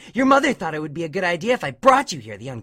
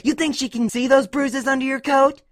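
high-pitched mocking tirade; taunting; 3 dB louder than usual. A narrator's reading. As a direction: pile on the questions to belittle the kid. Dash taunts and threatens sobbing Scootaloo.

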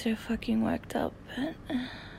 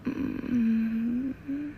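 ordinary delivery

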